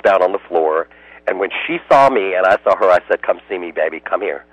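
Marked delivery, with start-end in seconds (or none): 3.2-4.4 soft, lower coaxing call; gently coax, retelling it quietly; tender coaxing. Retold or imitated. Rescuer retells calling the frightened dog over, tender and soft.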